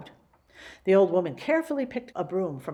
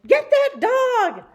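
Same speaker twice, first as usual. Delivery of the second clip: shout; 11 dB louder, much slower than usual. Acted. Witch shouting chase.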